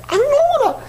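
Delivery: mock grumpy husband call; impatient old husband calling. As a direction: yell for her to hurry.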